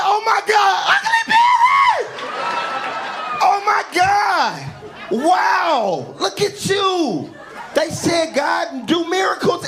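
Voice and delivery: excited shriek. Retold or imitated. Old crush recognizes him, shrieking excitedly. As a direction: shriek with delighted shock like a long-lost friend.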